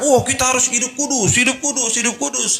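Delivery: high-pitched imitation of a zealous preacher; mock zealous preaching. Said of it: "untuk jemaat itu jor-joran".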